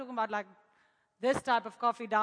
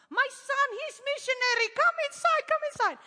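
much higher, louder welcoming voice; excited landlady; 12 semitones higher, 6 dB louder than usual. Retold or imitated. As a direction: invite him in eagerly.

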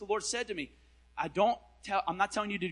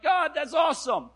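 much higher and louder; fervent mock shout of praise; excited shout; 10 semitones higher, 10 dB louder than usual. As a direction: cry out in awe. Emotional shout to God.

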